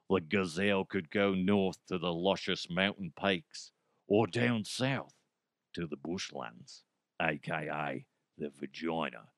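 low, soft Australian nature-guide voice; Australian accent. Retold or imitated. Host paraphrasing a movie's romantic scene, mock Australian accent. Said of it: "I say vagina in an Australian accent".

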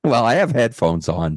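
usual speaking voice

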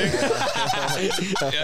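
high, loud-ish burst of laughter. Real, not acted. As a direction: laugh out high at your own story. Griner af egen historie. (højt, lyst grin)

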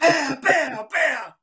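loud, high volley of hits; animated storyteller's sound effects; imitated blows. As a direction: punch out each hit separately.

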